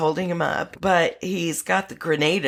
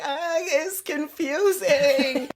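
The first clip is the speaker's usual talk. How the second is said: high, drawn-out mock whine; Jonah Hill; 8 semitones higher, slower than usual. Retelling a movie character's line, in a high drawn-out mock voice. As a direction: stretch it out high and slow. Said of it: "Jonah Hill's like".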